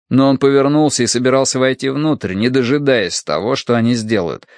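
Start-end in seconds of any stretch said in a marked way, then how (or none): none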